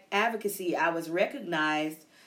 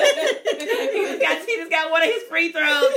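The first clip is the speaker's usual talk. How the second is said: high, loud, laughing cheer; excited cheering; 9 semitones higher, 8 dB louder than usual. Retold or imitated. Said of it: "I be like".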